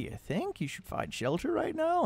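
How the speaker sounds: very high, drawn-out mocking question; hammed-up sarcastic astonishment; sarcasm. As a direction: squeal it in exaggerated disbelief. Sarcastic reply to companion.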